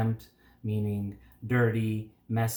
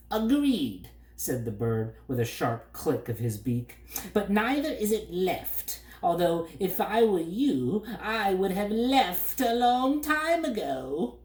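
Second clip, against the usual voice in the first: smart-aleck bird; 8 semitones higher than usual. A narrator's reading. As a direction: needle him like a know-it-all bird. Bird figure.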